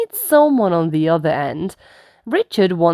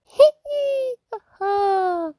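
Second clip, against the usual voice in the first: high piggy giggle; childish giggle; 3 dB quieter than usual. Acted. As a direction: giggle like a toddler at play. Children playing in the sandpit.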